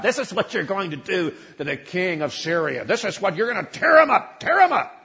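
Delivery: climbing to a high, loud, frenzied cry; wild prophet. Acted. A false prophet rousing the king, building to a loud frenzied cry. Prophet in horns dancing about.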